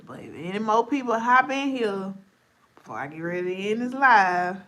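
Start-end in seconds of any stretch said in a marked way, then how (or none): none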